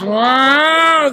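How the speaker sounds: high rising wail like an air-raid siren; siren imitation. Recalling the wartime siren for the interviewer, high wail. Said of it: "We heard this".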